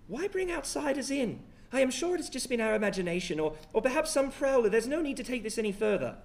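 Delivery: high, hurried, pleading lady; worried wife voice. Acted. GM voices Emily Bailey.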